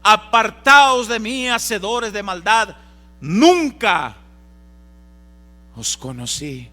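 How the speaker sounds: loud, slow, stern command; stern rejection. Acted. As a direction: cast them away, slow and loud. El Señor rechaza a los falsos. (rechazo severo, lento y fuerte)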